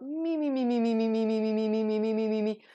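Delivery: stretching a sore throat; vocal warm-up. Real, not acted. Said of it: "let me just stretch my throat out".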